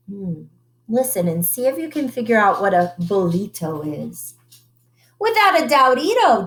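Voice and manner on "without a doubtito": declaring. Acted. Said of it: "declared El Skipito".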